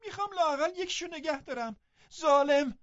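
put-on desperate girl's shriek. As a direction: scream at the cruel nurse. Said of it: "جیغ کشید".

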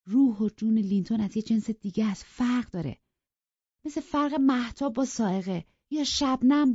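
lightly voiced young woman, earnest and confiding; Catherine's voice.

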